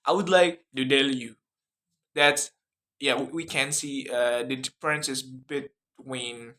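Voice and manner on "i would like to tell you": Indian accent with D for T. Shown as a demonstration. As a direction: imitate an Indian accent, "to tell" as "do dell". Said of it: "but in Indian accent".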